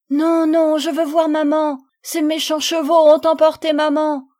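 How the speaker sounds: put-on a small child refusing, crying for maman; upset little girl. Little girl refuses, wants mother. (petite fille bouleversée, voix d'enfant aiguë et forte)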